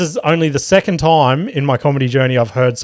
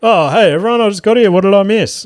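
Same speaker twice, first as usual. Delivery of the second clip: higher, louder breezy 'just got here' act; casual act; 7 semitones higher, 3 dB louder, faster than usual. Retold or imitated. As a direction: play it cool like you just walked in. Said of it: "played the old casual fucking".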